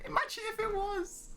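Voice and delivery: very high-pitched squeal of delight; amused disbelief. Real, not acted. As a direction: squeak it out, tickled by the idea. Amused at the silly surname.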